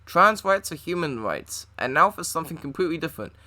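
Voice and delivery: normal talking voice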